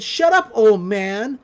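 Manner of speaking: loud, slow mock outburst; acted defiance. Mabel stands up to uncle, mock defiant. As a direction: talk back boldly, each word stretched. Mabel talking back to Stan.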